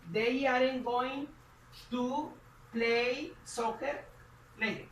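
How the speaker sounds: stretched out at x0.5 pace; slow teacher model; slow model sentence. Shown as a demonstration. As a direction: spell it out slowly for the class. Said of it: "Entonces diríamos".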